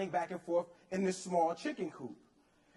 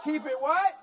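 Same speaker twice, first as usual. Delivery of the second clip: louder, higher rising question; loud crowd prompt; 6 semitones higher, 6 dB louder than usual. Real, not acted. Speaker getting the audience to finish the point, loud rising call-out. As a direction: prompt the audience to shout it back.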